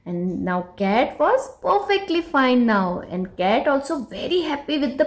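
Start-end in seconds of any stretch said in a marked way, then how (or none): none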